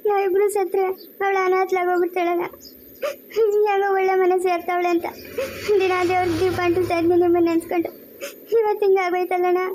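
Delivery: tearful plea on a steady pitch; crying. Acted. Mother fears her daughter will be left orphaned, crying. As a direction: sob through a mother's lament. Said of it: "ಅಳ್ಬೇಡ್ರಿ ನೀವು".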